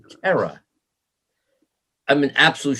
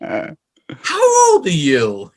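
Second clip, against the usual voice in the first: very slow, louder, stretched-out question; slow drawn-out question; 4 semitones higher, 4 dB louder, much slower than usual. Retold or imitated. Rocker quizzing a kid at the bar, slow and drawn out. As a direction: draw each word out like a sceptical adult. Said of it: "You know, just like that".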